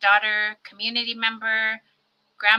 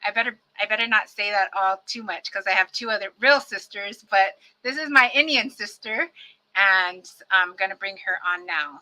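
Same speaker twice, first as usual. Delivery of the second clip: usual speaking voice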